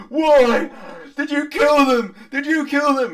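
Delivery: high, very loud accusing scream; distraught father screaming at the hags; anguished demand. Acted. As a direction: demand an answer through grief, at full volume. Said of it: "he demands of the hags".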